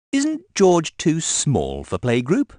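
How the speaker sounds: put-on a child's worried question, slowly; Peppa asking. A narrator's reading. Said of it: "asks Peppa".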